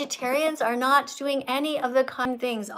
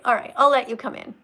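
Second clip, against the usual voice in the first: usual speaking voice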